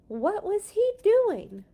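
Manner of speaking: high, loud and slow, full of wonder; dramatic excited reread. A narrator's reading. Reader redoes the line with excitement, high and loud. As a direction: lift it big and slow with suspense. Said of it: "That was exciting to read".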